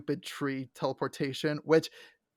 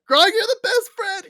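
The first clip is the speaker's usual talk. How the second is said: much higher, louder gush; 7 dB louder than usual. Real, not acted. Fan gushing over Grog, excited. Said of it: "I'm just like".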